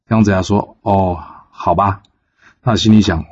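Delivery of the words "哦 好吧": a disciple sighing his assent to his master; reluctant okay. Acted. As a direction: drag it out like an unwilling yes. Reluctantly agreeing to errand.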